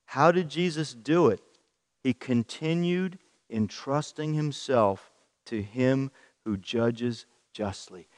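ordinary delivery

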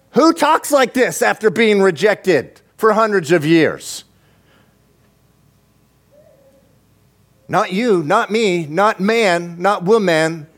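high-pitched, incredulous questioning; impassioned preaching. Real, not acted. Preacher marvels at God's love after rejection, impassioned. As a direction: drive each point home, pitched high.

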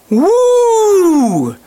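high, loud, long whoop; excited whoop. Real, not acted. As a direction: whoop it out high, sliding down at the end. Preacher whoops at David's bold reply.

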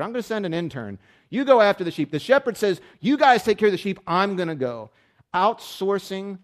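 loud, high, driving sermon delivery; full-throated pulpit preaching; preaching at full force. Acted. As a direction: preach it hard to the room.

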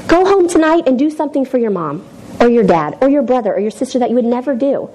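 normal talking voice

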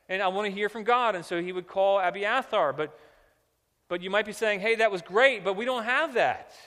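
loud, high, animated pulpit voice; full-force sermon storytelling; impassioned preaching. Real, not acted. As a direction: preach it hard, acting out the objections and calls.

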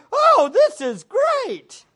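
loud, high, drawn-out gushing; mock elation. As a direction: gush slowly in exaggerated delight. Acts out delight at money gift.

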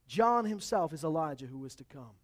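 usual speaking voice

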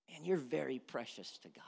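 hushed, confiding whisper. Real, not acted. Preacher whispering to the congregation, hushed. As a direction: breathe it like a secret.

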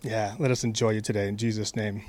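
soft, low opening prayer. Real, not acted. Pastor opens the sermon by praying softly for everyone. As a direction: pray quietly over the room.

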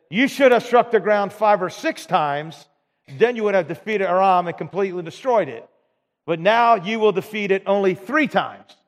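angry prophet. A narrator's reading. Dying prophet rebukes the king for striking thrice, angry. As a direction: rebuke him for stopping short. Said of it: "the man of God was angry with him".

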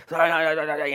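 high, loud rattled-off sorries; rushed grudging apology. Retold or imitated. Kid forced to apologize to his brother, rushed and grudging. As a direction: blurt out a hammed-up insincere apology.

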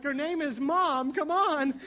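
high, rising mock exasperation; exasperated kid. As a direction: act out an impatient child pleading. Lost child exasperated at intercom.

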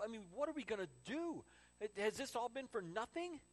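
high-pitched, agitated despair; plead, rising into desperation; acted panic. Disciples after losing Jesus, panicked and despairing. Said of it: "what was going through the disciples' minds".

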